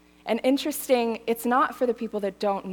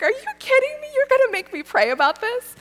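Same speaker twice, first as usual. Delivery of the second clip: incredulous; 14 semitones higher, 4 dB louder, faster than usual. Retold or imitated. Retold incredulous reply to friend.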